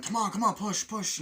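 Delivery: high, loud cheering-on; urgent urging. Retold or imitated. Friends trying to push the car, urgent urging. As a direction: urge someone to shove with all their might. Acting men pushing a car.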